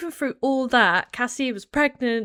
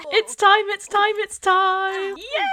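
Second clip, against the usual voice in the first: high, loud squealing chant; excited squeal; 11 semitones higher, 5 dB louder, slower than usual. Real, not acted. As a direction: bounce on each word. Labor starts in game.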